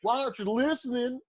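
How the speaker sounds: put-on Southern accent. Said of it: "when you say stuff in a Southern accent".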